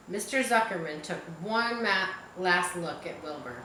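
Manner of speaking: plain reading voice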